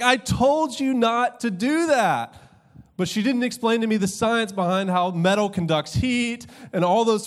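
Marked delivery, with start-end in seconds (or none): 0.0-2.4 high, loud, slow mock mom scold; shrill I-told-you-so from mom. Preacher imitating his mom after the burn, high and loud. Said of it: "My mom's like".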